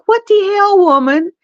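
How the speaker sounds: mock shocked outburst. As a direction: play the husband's jaw-drop surprise, lightly. Husband's shocked outburst retold.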